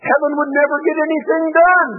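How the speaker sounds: high, loud and bright punchline; comic preacher's punchline. Acted. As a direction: land the joke on the crowd. Punchline after God voice.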